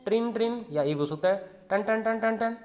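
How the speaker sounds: high ringing trin trin, like a bell; playful bell noises for children; bell sound imitation. Shown as a demonstration. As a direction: chime it out for the class. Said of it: "घंटी की ध्वनि कैसे बजती है".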